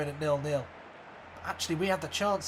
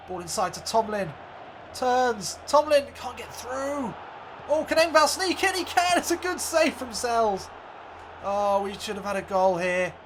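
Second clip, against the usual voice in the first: live commentary. Acted. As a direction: call the match, then lift for the attack. Commentator calling the match.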